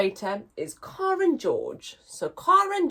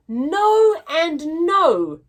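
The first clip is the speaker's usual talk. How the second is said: slow, louder weary denial; fed-up no; 6 semitones higher, 6 dB louder, much slower than usual. Acted. Joe, fed up, slowly denies both silly guesses. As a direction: drag out the double no.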